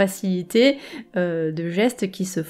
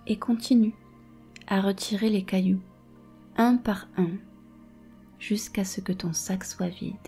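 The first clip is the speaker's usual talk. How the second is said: guided meditation. Acted. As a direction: calmly guide each image. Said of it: "Je vais te guider dans une méditation".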